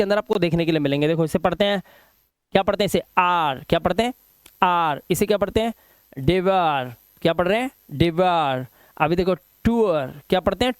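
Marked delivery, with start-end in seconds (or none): none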